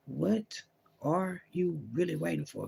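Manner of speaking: hushed, slowed God voice asking again; a hint of God pressing softly. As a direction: lean in and slow down as God. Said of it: "God's like".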